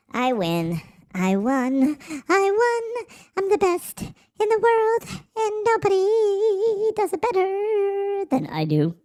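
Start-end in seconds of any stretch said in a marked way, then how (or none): 2.3-3.1 very high, squeaky boast; high-pitched cartoon voice. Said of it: "when I do the high-pitched voice". 3.4-8.2 very high, slow squeaky brag; high-pitched cartoon voice. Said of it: "when I do the high-pitched voice".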